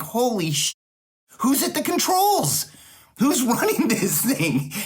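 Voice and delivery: retold disbelief. Recalling his shock that Freddie Mac wanted his slides, high incredulous. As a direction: hear the alarm and laugh at it. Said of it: "but I'm like".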